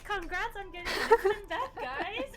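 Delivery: loud, high, laughing cheer; excited cheer. Real, not acted. Celebrating a team's instant death.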